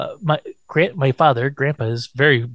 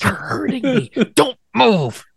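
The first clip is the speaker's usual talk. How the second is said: much higher, strained put-on voices; mock pained exchange; 9 semitones higher, slower than usual. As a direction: squeal it out in mock pain. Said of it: "He, like, grabs onto her leg".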